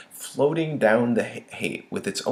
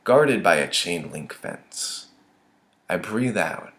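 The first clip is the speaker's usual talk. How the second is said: usual speaking voice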